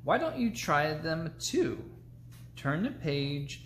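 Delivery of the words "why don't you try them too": usual speaking voice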